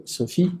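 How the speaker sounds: "Sophie" with PH said as a clean F, stress on the last syllable; French pronunciation. Shown as a demonstration. Teacher showing students the French PH sound, Sophie with an F. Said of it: "crea el sonido, eh, equivalente al de una F".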